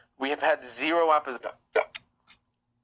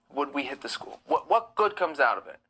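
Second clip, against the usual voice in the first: usual speaking voice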